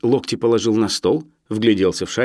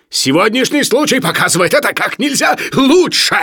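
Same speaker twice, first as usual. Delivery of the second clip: high, loud outburst; furious shout; 14 semitones higher, 6 dB louder than usual. Acted. Professor cites today's incident against Sharikov, shouting. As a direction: yell it at full anger.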